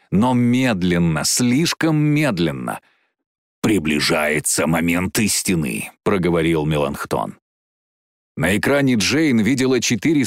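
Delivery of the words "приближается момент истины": low, ominous dragon; deep, measured creature voice; dragon's voice. Acted. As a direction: speak low as a dragon machine. Melanchthon, the dragon, speaks.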